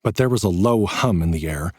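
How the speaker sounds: usual speaking voice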